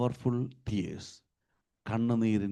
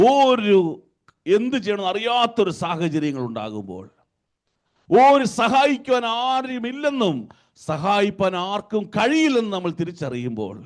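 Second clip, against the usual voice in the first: fast, raised Malayalam sermon delivery; impassioned preaching; 9 semitones higher, 7 dB louder, much faster than usual. Acted. Preacher describing helplessness to the congregation, impassioned preaching. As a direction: surge through the long phrases.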